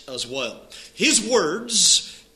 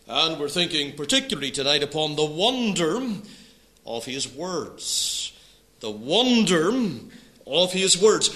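ordinary delivery

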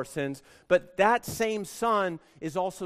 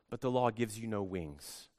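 normal talking voice

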